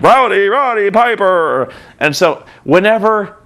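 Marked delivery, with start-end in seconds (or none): 0.0-1.7 slow, drawn-out announcer call; mock PA name call. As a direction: draw the name out like a ring announcer. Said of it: "just like".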